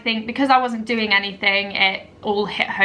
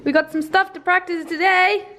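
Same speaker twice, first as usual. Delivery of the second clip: high pet-talk voice; 8 semitones higher than usual. Real, not acted. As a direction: coo at the puppies in a sing-song. Cooing to her dogs.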